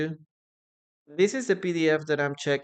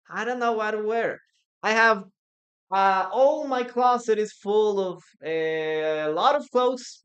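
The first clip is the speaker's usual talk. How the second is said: higher and louder, hammed-up wardrobe panic; mock fretting woman; 6 semitones higher, 8 dB louder than usual. Teacher imitates women who have nothing to wear, in a mock whine. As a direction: play a woman whining about her full closet. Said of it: "when women say something like this".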